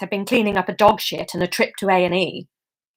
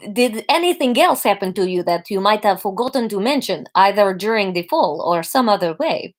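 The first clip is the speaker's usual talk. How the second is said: Gru-style Russian doctor; probing question in a Russian accent. Acted. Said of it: "This is Russian really based on Gru".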